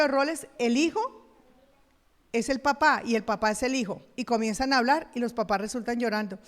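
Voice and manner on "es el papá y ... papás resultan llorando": normal talking voice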